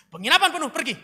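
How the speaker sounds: loud, high, ferocious shout; crueler, possessed. Acted. [name] rehearses evicting Mary, shouting fiercely. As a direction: throw them out like someone possessed. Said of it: "lebih kejam, matamu kayak kerasukan setan".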